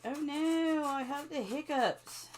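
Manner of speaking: put-on high whiny little kid. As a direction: whine it like a small child. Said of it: "I always feel like a little kid".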